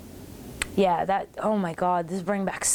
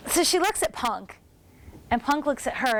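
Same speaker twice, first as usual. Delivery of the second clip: ordinary delivery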